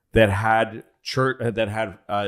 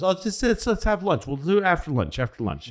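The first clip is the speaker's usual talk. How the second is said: airy, higher-pitched deflection; breezy brush-off; 8 semitones higher than usual. Retold or imitated. Roosevelt putting Churchill off until lunch, breezy brush-off. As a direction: wave them off lightly, pitched up.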